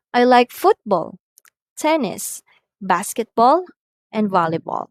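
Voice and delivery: "football", "tennis", "basketball" rising, "volleyball" falling; rising list, falling end. Shown as a demonstration. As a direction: rise on each sport, fall on "volleyball". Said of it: "intonation falls on the last item".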